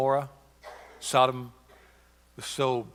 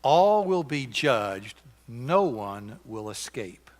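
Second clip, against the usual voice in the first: solemn slow warning; 2 semitones lower, much slower than usual. Real, not acted. Solemn verdict after the reading.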